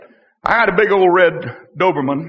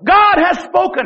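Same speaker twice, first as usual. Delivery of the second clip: high, slow proclamation bursting out; ringing preacher's cry; sudden outburst; 7 semitones higher than usual. Acted. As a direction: burst out as if unable to hold it in. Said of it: "all of a sudden I just have to open up".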